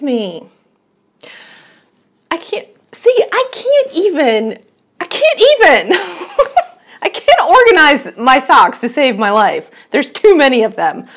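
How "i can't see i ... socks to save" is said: high, loud exasperated outburst, laughing; laughing high-pitched despair. Real, not acted. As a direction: throw up your hands, I can't even.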